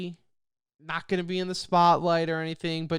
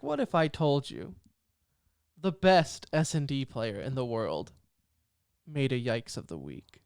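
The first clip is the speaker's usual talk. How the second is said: lightly voiced documentary trailer narrator, sinking lower; 4 semitones lower, 3 dB quieter than usual. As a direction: tease it like a sports documentary intro, dropping low at the end. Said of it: "a thirty for thirty, where it's like".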